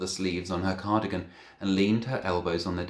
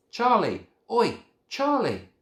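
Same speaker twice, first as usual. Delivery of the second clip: high, loud call across room; 8 dB louder, slower than usual. A narrator's reading. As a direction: shout his name across the room. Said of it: "I called across to him".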